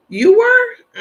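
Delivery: high, loud disbelief; surprised at a viewer's claim. Real, not acted. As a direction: shoot up in mock shock. Reacting to viewer's comment.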